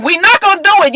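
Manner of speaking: hammed-up group yell; mock screaming refusal. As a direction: retell a scream of refusal, half-voiced. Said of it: "they were all screaming".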